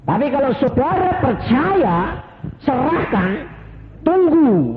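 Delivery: high, loud exhortation; fervent preaching. Acted. Preacher urging the congregation to surrender, high and loud. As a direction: rouse the congregation to trust.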